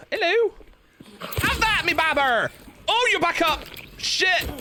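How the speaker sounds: a cheeky Bristolian calling the monsters; Bristol accent. Acted. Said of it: "I can feel my accent".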